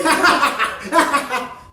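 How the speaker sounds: high, loud manic cackle; manic laugh. Acted. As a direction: cackle like a man who can't contain himself. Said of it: "laughing manically".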